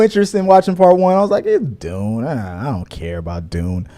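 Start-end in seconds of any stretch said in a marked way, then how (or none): 1.5-3.9 low, quiet, slow shrug of a voice; dismissive indifference. Retold or imitated. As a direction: recall not caring at all, voice dropped low. Acting out his earlier indifference.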